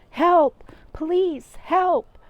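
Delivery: high, loud cries for help; calling for help. Acted. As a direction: yell it across the street. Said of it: "someone called out".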